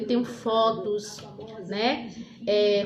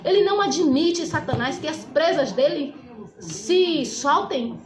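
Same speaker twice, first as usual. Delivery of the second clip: fervent preaching; 5 semitones higher than usual. Acted. Preacher confronting the despairing son, fervent and forceful. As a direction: preach it with fire and conviction.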